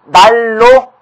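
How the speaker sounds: 날로 loud and high, with a long double L; liquid-assimilation demo. Shown as a demonstration. Teacher shows students liquid assimilation, loudly sounded out. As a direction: punch out 날로 with both L sounds. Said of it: "유음화 됐네요".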